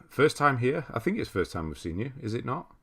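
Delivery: ordinary delivery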